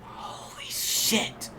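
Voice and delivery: breathless whispered awe; whispering. Real, not acted. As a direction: whisper it, stunned. Stunned by old memory.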